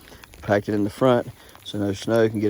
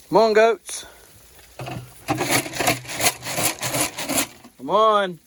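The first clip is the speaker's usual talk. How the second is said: calling goats; 10 semitones higher, slower than usual. Real, not acted. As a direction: holler for the goats to come.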